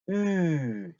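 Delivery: long, steady "으" as German ü; ü sound. Shown as a demonstration. Teacher demonstrating for learners, drawn-out rounded ü. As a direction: round the lips for oo and voice ee on "으". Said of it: "우 한 상태에서 소리만 이를 내니까".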